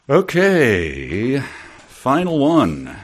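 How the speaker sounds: high, slow, drawn-out "final one"; dramatic announcement. Acted. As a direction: announce it like a game show host. Host announces the last item.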